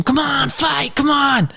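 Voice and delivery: high-pitched imitation of a coach yelling; mock yelling coach. Fighter imitating his coach yelling at the opponent, high mock yell. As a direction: egg him on in a squeaky mock yell. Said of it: "Brendan's all yelling him".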